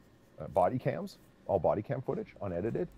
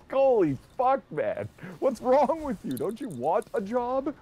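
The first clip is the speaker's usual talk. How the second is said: high, loud laughing outburst; cracking up in disbelief; real incredulous laughter; 4 dB louder, slower than usual. Real, not acted. Laughing disbelief at drunk commander.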